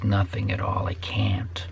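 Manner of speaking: whispered in despair, trailing away; hopeless whisper. Real, not acted. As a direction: drop to a defeated whisper. Despairing over toddlers, trailing off.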